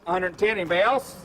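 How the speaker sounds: auctioneer call. Real, not acted. Auctioneer calling bids.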